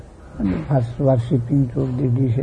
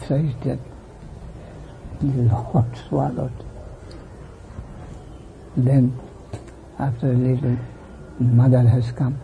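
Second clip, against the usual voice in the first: usual speaking voice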